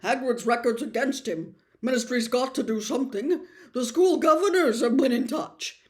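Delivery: squirming minister pushing his case; uneasy Fudge. Acted. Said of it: "said Fudge uncomfortably".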